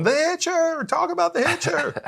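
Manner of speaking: very high-pitched gushing fan cry; fan outburst. Acted. Fan who had to restrain himself around the actor, high excited outburst. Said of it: "restrain myself from not going".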